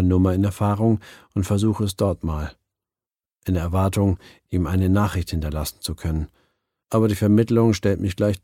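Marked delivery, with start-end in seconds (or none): none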